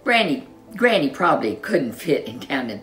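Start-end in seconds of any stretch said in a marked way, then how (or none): none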